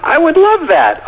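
delighted, very high. Real, not acted. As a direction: gush it out high and slow. Delighted at VeggieTales idea.

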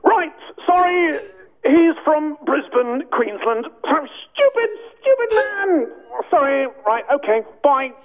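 high mock John Cleese rant; John Cleese impression. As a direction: play Cleese flustered and rude. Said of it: "John Cleese".

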